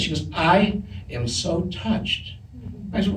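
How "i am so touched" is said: slow, softly spoken mock sincerity; imitated heartfelt thanks. Co-star thanks him for the rose, imitated slow sincerity. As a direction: draw out each word as if deeply moved.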